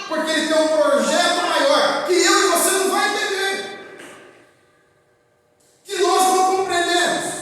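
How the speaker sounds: loud, high, excited proclamation; carried-away loud preaching. Real, not acted. Preacher telling the church of God's bigger project, carried-away loud preaching. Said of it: "eu acabo falando mais alto".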